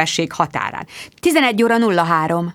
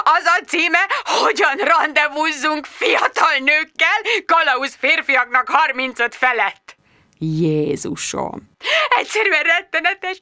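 high, wailing friend; tearful Jude; 6 semitones higher than usual. Acted. Jude sobbing down the phone over her ex, lightly wailing. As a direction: wail it, near tears. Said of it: "Jude zokogott birka hangon".

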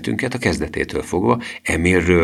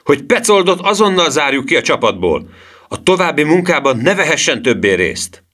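high, loud, sustained outburst; indignant shout; 9 semitones higher, 5 dB louder than usual. A narrator's reading. Professor demanding Petzold be thrown out, indignant shout. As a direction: shout the demand to the whole gang. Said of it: "kiáltotta a professzor".

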